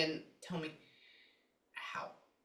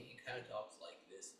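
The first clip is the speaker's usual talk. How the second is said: a lightly lowered, softer voice for him; imitating his low voice; 7 semitones lower, 14 dB quieter than usual. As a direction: drop the voice a little to mimic him. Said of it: "like, right, not that low".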